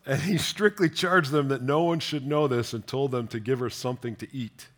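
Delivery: normal talking voice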